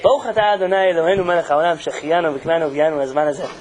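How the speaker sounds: low, chanted Hebrew blessing over a guest; blessing recited. Real, not acted. Rabbi blessing the guest, reciting the prayer low. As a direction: intone it like a prayer. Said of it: "Can I make a bracha on you?".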